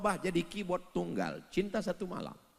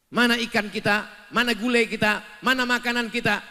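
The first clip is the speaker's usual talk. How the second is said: loud, higher impatient demands; demanding husband; 8 semitones higher, 9 dB louder than usual. Acted. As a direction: press for the food. (suami menuntut dengan keras)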